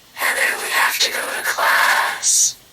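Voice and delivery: breathless whispered voice effect; whisper filter. Shown as a demonstration.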